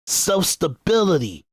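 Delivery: ordinary talk, nothing marked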